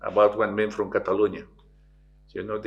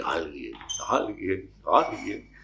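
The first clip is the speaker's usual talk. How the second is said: fading echo repeating; 4 semitones lower, 3 dB quieter, slower than usual. Retold or imitated. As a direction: let it die away like an echo. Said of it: "Y el eco repite". (eco que se apaga)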